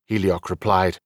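usual speaking voice

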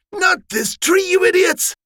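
put-on older brother scolding clumsy siblings; exasperated scolding. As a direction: snap at them to scatter. Roger shooing brothers away.

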